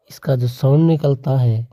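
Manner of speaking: usual speaking voice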